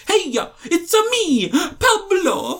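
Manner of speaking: high, exaggerated Italian accent; mock Italian accent. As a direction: ham up a cartoon Italian accent. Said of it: "he literally comes out like".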